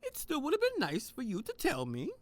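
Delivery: high, wounded reproach; hurt Hans. Acted. Hans learning his ex kept a secret, high and hurt. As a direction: let the pitch climb with the hurt.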